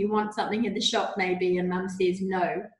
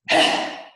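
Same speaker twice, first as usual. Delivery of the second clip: big noisy sigh out; loud volcano breath out; loud tantrum exhale; 6 dB louder than usual. Shown as a demonstration. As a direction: blow it out like a volcano. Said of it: "make that noise as well".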